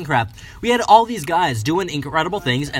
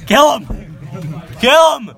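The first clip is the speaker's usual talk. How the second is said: rising higher and louder yells; battle cry; 7 semitones higher, 4 dB louder, slower than usual. Pretend. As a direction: egg them on, yelling. Mock battle cry at friend.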